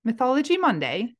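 British accent, confidently found, lifting at the end; put-on British accent with a rising tune. Shown as a demonstration. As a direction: land the British accent brightly. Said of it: "There it is".

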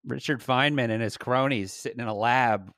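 ordinary talk

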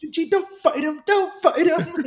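high mock plea, stretching out the repeat; hammed-up, high-pitched begging; imitated pleading. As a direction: imitate a wife holding back a husband. Wife holding speaker back, pleading.

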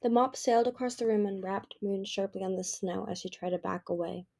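ordinary delivery

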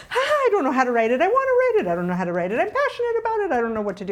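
high, fast, loud mock panic; frantic spiral of not knowing how to write; half-laughing panic over writing the movie; freaking out. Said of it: "freaking out".

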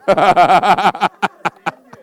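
loud, high belly laugh; long, helpless laugh; real laughter. Real, not acted. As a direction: burst out laughing at your own joke. Laughs at own accent attempt.

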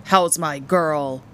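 slow, hearty booming greeting; booming father. A narrator's reading. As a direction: boom it out to a beloved daughter. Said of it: "he boomed".

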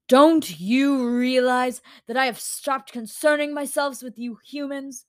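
spitting back. A narrator's reading. Godlike creature to pleading humans, spitting back. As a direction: throw it back with loud contempt. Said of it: "the Dreamkeeper spat back".

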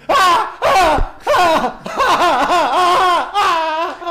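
loud, high screams switched on abruptly; hammed-up terror yells in a long string; sudden mock scream. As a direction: burst into repeated nightmare screams for a joke. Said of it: "Qué buen switch".

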